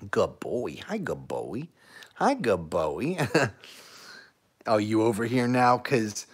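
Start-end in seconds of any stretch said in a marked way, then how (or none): 0.1-3.2 cooing to the dog. Real, not acted.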